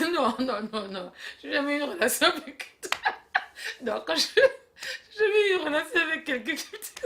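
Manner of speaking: high words broken by laughter; laughing so hard it almost turns to crying. Acted. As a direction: talk through tears of laughter. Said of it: "mais arrête de rigoler".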